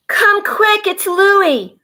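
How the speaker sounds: high, loud call for help; child's scream. Acted. Girl screaming to Mommy about baby Louie, high and loud. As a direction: scream for Mommy to come quick. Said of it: "Mommy heard Cassie scream".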